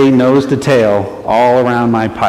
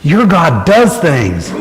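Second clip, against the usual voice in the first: awed driver quote; 3 semitones higher, slower than usual. Retold or imitated. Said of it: "he looks at me and he goes".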